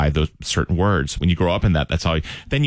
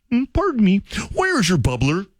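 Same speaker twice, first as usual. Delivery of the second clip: put-on high, froggy Kermit-like child voice; 12 semitones higher than usual. Nervous new kid asks teacher for water, Kermit voice. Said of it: "Kermit's in full effect before I even knew I sounded like Kermit".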